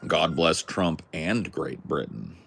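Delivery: ordinary talk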